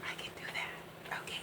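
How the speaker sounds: breathy whispered agreement; whispered reply. Retold or imitated. Agreeing to eat before readings, whispered. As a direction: whisper it back softly. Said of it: "And I said".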